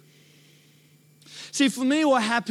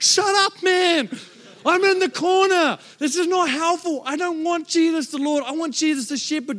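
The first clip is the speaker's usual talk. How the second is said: mock outburst; 4 semitones higher than usual. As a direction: snap back, fed up. Angry retort in church.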